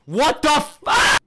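high, loud, drawn-out yell; startled, exasperated scream; shocked outburst. Real, not acted. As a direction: shout in disbelief at the game. Game glitches on him.

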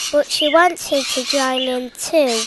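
ordinary delivery, nothing marked

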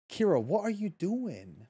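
ordinary delivery

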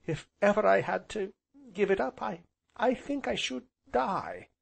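high-pitched passionate outburst; passionate cry. A narrator's reading. Heroine on losing her only home, passionately. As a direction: let the voice lift high with feeling. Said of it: "she cried passionately".

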